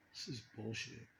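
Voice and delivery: very soft, low aside; muttered reaction. Retold or imitated. Man retelling his reaction to what comes out, muttered low. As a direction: say it under your breath, low.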